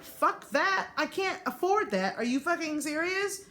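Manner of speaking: high-pitched, incredulous retold outburst; indignant refusal. Patient refusing the costly ambulance ride, indignant and high. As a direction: re-enact balking in disbelief at the price. Outraged reply to ambulance price.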